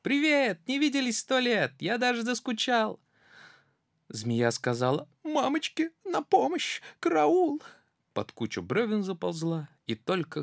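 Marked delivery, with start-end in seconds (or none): 0.0-3.0 high friendly squeak; cheery mouse. Acted. Mouse greeting the snake, high and cheery. 5.2-7.6 very high panicked cry; terrified snake. Acted. Snake fleeing the gruffalo, high panic.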